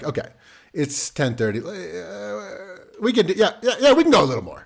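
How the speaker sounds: high, laughing self-bargaining at bedtime; pleading bargainer. Retold or imitated. Dad bargaining with himself over bedtime, laughing and pleading. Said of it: "I'm like".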